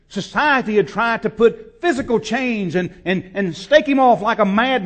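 loud, high, driving sermon climax; preaching at full force. Real, not acted. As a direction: build to a roaring preach.

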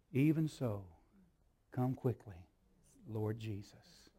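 soft, slow, fading plea to the Lord; soft prayer. Acted. Hyacinth praying for the Lord to come, softly. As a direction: breathe it out as a tender prayer. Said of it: "she could say".

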